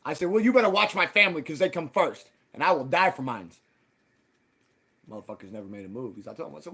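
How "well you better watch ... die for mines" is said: louder, fierce warning about his family; retold threat. Warning the men he will die for his family, fierce. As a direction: warn them you will protect your family.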